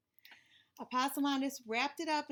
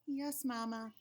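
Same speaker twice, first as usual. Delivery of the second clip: ordinary delivery, nothing marked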